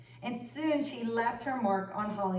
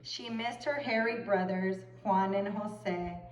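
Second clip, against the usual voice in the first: ordinary delivery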